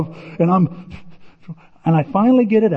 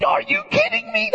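exasperated disbelief. Retold or imitated. Tim staring at his sliced-open hand, high exasperated squeal. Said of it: "That's what I do".

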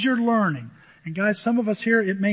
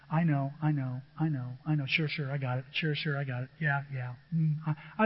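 softer, lower brush-off of advice; dismissive brush-off; 4 semitones lower, 6 dB quieter than usual. Retold or imitated. Person brushing off someone trying to help, dismissive and softer. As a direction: mutter I know I know, half listening. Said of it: "said the same thing I've said".

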